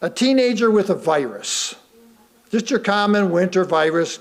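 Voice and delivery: ordinary talk, nothing marked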